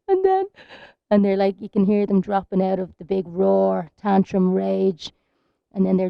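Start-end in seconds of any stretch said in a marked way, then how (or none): 0.1-0.5 put-on sobbing toddler.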